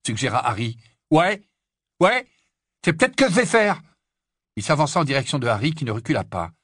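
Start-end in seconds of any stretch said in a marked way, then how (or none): 1.1-3.8 high, loud rising challenge; defiant retort. Acted. Ron takes up Harry's dare to go home, defiant. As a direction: fling it back at him, raising the pitch.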